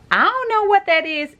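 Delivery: high-pitched, held-up note of cluelessness; baffled high pitch. Real, not acted. Vlogger clueless about the score's name, baffled high pitch.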